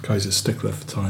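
hushed aside at the door; quiet at night. Acted. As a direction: mutter so he won't wake. Waiting at door at eleven.

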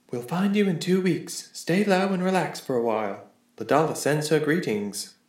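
note read aloud. Acted.

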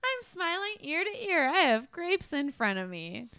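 high, sing-song mock cheerfulness; sarcastic cheer. Actress insisting she's happy in a horrible mood, sarcastic cheer. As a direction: play up the fake happiness, pitched high. Acted sarcastic interview in reenacted scene.

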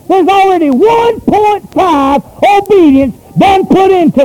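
shouting preacher voice; preaching at full force. Acted.

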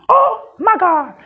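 high, slow, whiny mock panic; squeaky nonsensical wail; squeaky panicked voice. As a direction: squeak it out like it's the end of the world. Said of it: "in your squeaky, nonsensical voice".

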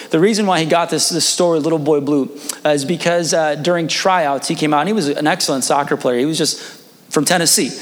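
ordinary delivery

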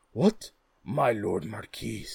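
loud, startled royal cry; surprised king. Acted. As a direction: exclaim it in royal astonishment. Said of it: "cried the king".

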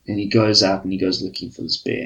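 ordinary talk

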